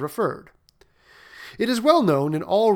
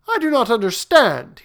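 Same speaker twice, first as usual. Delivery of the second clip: higher, troubled prince; baffled and complaining; perplexed prince; 8 semitones higher, 7 dB louder than usual. Acted.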